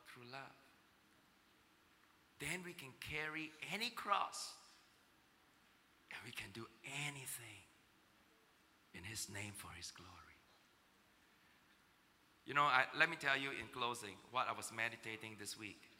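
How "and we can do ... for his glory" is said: much softer, low whisper; hushed whisper. Real, not acted. Preacher closing his point to the church, whispered. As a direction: drop to an intimate whisper.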